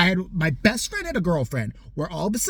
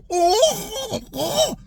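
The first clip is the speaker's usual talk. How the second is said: mock wounded cry; 3 dB louder than usual.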